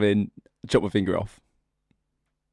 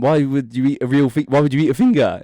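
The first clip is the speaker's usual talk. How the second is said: louder, incredulous question; mock disbelief; 5 semitones higher, 7 dB louder than usual. Telling the joke, asking the mum why she ate a finger, mock disbelief. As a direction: sound baffled at the girlfriend's mum. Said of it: "I went".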